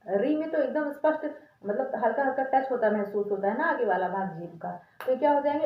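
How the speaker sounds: normal talking voice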